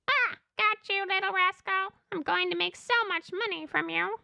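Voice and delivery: high, gloating villain voice; alien hunter. Acted. Hunter gloats over the netted alien, put-on villain. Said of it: "Alien hunter number one".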